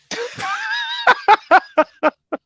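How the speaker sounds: a big high shouted laugh that falls away; howling laugh; loud laugh. Real, not acted. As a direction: burst out laughing at the story. Big laugh at the insult story.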